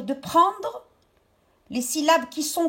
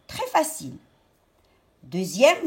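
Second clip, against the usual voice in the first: normal talking voice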